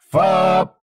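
short honk sound; one loud beep; car horn. Acted. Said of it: "ступа просигналила".

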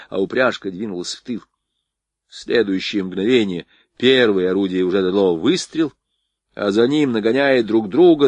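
usual speaking voice

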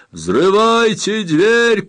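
high, loud, drawn-out order; shouted command. A narrator's reading. Trapped captain orders door blown up, shouting. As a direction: cry the order over roaring water. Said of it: "крикнул он".

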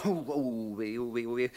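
soft, low wail of woe; fretful moaning. Acted. Lookout bewailing the coming attack, fretful moan. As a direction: moan softly in dread.

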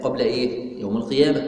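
usual speaking voice

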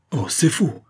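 high, sharp cry of denial; outraged cry. Acted. Victor crying out in denial at Cardasol's claim. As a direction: cry out against the lie, pitched high. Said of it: "s'écria Victor".

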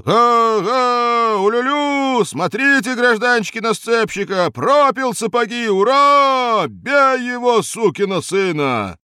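high, loud hooting mob; jeering crowd. A narrator's reading. The street crowd hoots at the bootless switchman, loud jeering. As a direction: whoop and jeer at him. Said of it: "улюлюкала и выла".

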